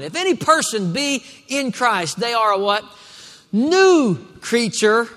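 high, ringing scripture declaration; preaching proclamation. Acted. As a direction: proclaim it out to the whole congregation. Preaches scripture with call and response.

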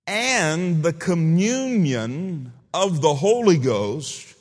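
very slow, ringing benediction; scripture proclamation. Real, not acted. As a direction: proclaim each phrase with stretched words and long pauses. Reads scripture verse aloud solemnly.

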